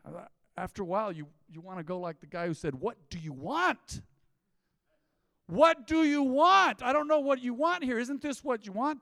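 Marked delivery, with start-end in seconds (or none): 2.8-4.0 slow, drawn-out question rising at the end; imitated exasperation. Mimics exasperated guy's famous question. 5.5-9.0 high, loud, acted outburst; exasperated shout. Mimics exasperated guy, repeating it louder.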